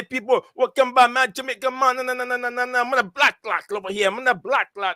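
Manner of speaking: high-pitched, playful mock-Jamaican stream intro; attempted Jamaican patois. Host showing how he'd open the stream, playful mock-Jamaican patois. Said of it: "you need to teach me patois".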